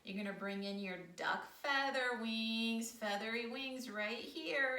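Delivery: ordinary talk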